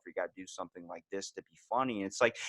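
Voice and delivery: ordinary talk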